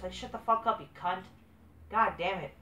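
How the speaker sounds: ordinary delivery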